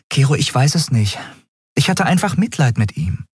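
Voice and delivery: mildly pleading captain; defensive captain. A narrator's reading. Captain justifies herself to beast-man.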